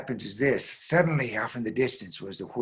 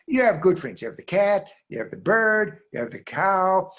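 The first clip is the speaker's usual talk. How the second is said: louder, higher, emphatic father; insistent father; 8 semitones higher, 7 dB louder than usual. Acted. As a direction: count them off insistently, louder. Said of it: "So the father said".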